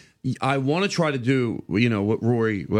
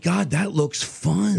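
slow, higher-pitched wishful thought; retold admiring wonder; 6 semitones higher, much slower than usual. Comic watching a looser comedian perform, retold admiring wonder. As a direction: draw it out like marvelling at someone.

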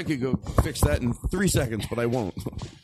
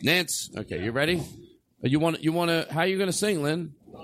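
ordinary talk, nothing marked

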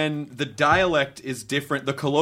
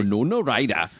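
Geordie accent; 4 semitones lower than usual. Shown as a demonstration. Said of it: "I would just say that".

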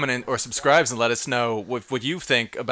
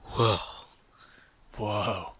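Keanu whoa; 4 semitones lower, 5 dB quieter than usual. Pretend. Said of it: "Keanu Woe".

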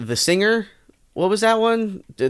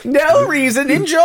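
giggly; 11 semitones higher than usual. Real, not acted. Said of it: "[name], why are you giggling?".

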